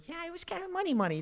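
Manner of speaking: airy reply, pitched well up; tossing off a carefree answer about money; breezy high reassurance. Retold or imitated. A man brushing off his wife's money worry, airy and high.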